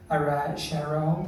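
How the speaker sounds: low, slow attempt at London lingo; mock London accent. Customer imitating the cashier, mock London accent. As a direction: drop low and drag out a London greeting. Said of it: "I'm gonna crack this".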